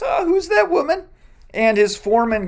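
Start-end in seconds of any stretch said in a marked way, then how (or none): 0.0-1.0 much higher, intrigued question; curious Boaz. Retold or imitated. Boaz notices Ruth in his field and asks, intrigued. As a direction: perk up at spotting her. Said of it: "Boaz looks out and he goes".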